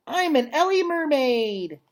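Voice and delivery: put-on a delighted kid showing off; little Ruby. Child elephant Ruby playing.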